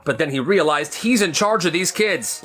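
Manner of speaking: normal talking voice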